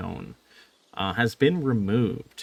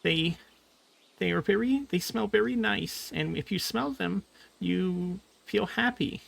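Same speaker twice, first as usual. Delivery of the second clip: high, gentle little voice; high little creature voice; tiny creature voice; 8 semitones higher, 4 dB quieter than usual. Acted. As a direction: chirp it like a foot-tall friend. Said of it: "He says".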